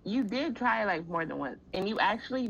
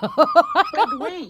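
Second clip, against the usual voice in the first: laughing; 13 semitones higher, 13 dB louder than usual. Real, not acted. Laughing interruption.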